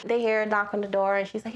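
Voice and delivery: ordinary talk, nothing marked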